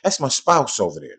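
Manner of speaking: a hint of a goofy husband; mock guy saying spouse. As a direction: lightly parody the wrong phrasing. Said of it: "not a word men use and say".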